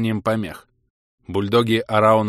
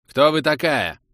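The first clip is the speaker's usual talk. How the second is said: sharp, raised demand; 6 semitones higher, 4 dB louder than usual. A narrator's reading. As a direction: cut in with a sharp question. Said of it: "Резким тоном". (резким тоном)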